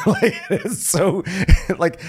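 laughing through words; breaking up laughing. Real, not acted. Laughing at the scene.

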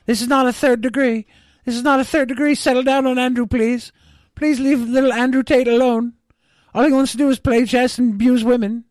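high-pitched mock pleading on a client's behalf; hammed-up protective lawyer, voice pushed way up. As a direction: beg them in a high, put-on whiny lawyer voice. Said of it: "the lawyers are like".

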